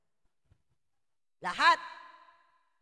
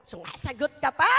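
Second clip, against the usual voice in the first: put-on a louder parent snapping at a child; mock scolding; 2 semitones lower, 7 dB louder than usual. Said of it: "Ano sabi mo?".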